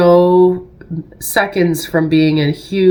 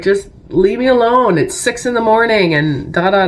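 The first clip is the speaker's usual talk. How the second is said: retold snapping at her husband, higher and sharp; wife snaps at her husband early in the morning, irritated and raised; irritable snap, raised pitch; 6 semitones higher than usual. Wife snapping at husband at six a.m., raised irritation. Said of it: "I kinda snapped on him".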